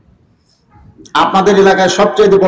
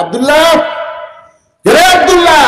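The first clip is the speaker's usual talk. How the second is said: calling out; 9 semitones higher than usual. Acted. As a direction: call him awake, pitched well up. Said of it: "গভীর রাত্রে ডাক দেয়". (উঁচু গলায় ডাক দেওয়া)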